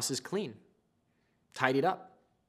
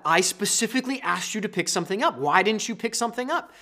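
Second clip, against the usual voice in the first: higher-pitched, accusing wife; livid wife; 6 semitones higher than usual. Acted. Livid wife to forgetful husband, accusing. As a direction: nag in mock exasperation, pitch climbing. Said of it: "Your wife is livid".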